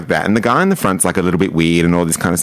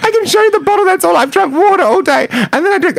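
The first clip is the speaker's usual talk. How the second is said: high, louder mock sobbing plea; imitating crying; 3 dB louder than usual. Retelling a friend pleading with the cop, in mock tears. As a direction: whine it through fake tears. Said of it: "She started to cry".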